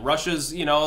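ordinary talk

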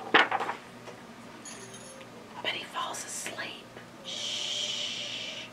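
breathy hush; whisper. Real, not acted. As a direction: whisper so he stays asleep. Reading, child asleep.

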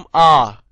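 啊 as a full, long, loud open "aa"; stressed A. Shown as a demonstration. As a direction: give 啊 full stress, held open and strong. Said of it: "重音啊". (重音啊，拉長大聲讀)

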